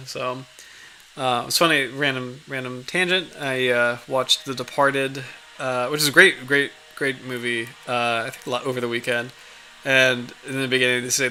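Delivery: ordinary talk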